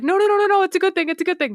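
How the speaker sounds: high, loud rushing reassurance; eager reassurance. Retold or imitated. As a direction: rush to reassure them. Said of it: "they're like".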